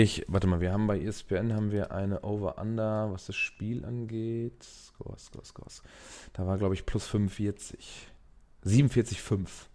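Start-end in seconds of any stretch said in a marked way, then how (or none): 4.6-5.8 very soft muttering while searching; muttering to himself. Real, not acted. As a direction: mumble it under your breath. (leise vor sich hin murmeln)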